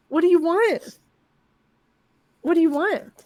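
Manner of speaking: high-pitched doting question; talking to a pet. Real, not acted. Owner to pet climbed into lap, high pet-talk voice. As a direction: ask the pet in a high little voice.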